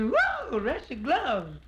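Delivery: high, loud squealing whoa; a woman marvelling at the suitor's coat; woman's whoa. Retold or imitated. Woman impressed by his gloves.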